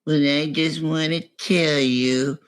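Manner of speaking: imitating someone turned strange, very slow; slow, drawn-out mock confession; morphing into another being. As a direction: drag the words out in a strange put-on voice. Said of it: "morphs into this other being".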